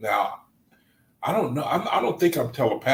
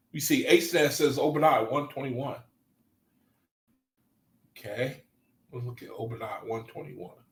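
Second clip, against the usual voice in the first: ordinary talk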